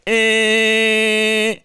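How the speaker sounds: long, loud, high horn blare; held car horn. Retold or imitated. Driver holding the horn on a double-parked car, long loud blare. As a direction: imitate leaning on the horn. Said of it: "I held the bo-, the horn like".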